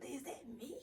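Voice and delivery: whispered disbelief at own voice; breathy whisper of surprise; shocked whisper. Retold or imitated. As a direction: hush it, stunned. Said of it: "I thought".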